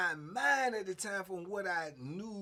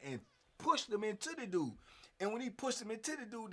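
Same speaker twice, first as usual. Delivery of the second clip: usual speaking voice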